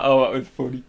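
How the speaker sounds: ordinary delivery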